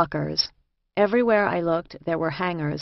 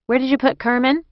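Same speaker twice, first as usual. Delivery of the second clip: higher, louder businesslike question; all business; 8 semitones higher, 5 dB louder than usual. A narrator's reading. Escort pokes head into counselor's office, all business. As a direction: ask it briskly, all business. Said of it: "all business".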